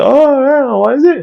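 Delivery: very high-pitched mock mum voice; squeaky-high retold question from a mother; imitating mother. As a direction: shoot way up high to mimic your mum. Voicing mother in retold story.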